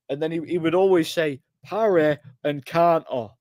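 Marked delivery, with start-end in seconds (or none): 1.6-3.3 slow, higher Nottingham drawl on the catchphrase; mock the coach's Nottingham catchphrase; Nottingham accent catchphrase. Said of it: "really strong Nottingham accent".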